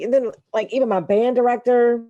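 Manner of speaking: usual speaking voice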